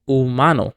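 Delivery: "humano" stressed on MA, the second to last syllable; penultimate stress. Shown as a demonstration. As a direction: lean on the middle of "humano": hu-MA-no. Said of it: "the stress is on the second to last syllable".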